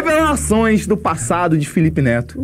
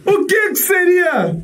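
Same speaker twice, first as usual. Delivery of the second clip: mock shock; 12 semitones higher, slower than usual.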